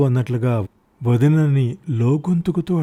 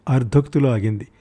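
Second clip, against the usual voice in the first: plain reading voice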